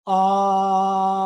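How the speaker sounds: one sustained projected ah on the breath; long held ah. Shown as a demonstration. Teacher demonstrating a held vowel for the class, projected and steady. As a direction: hold the ah steady for five counts. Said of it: "recite the ah sound for five finger counts".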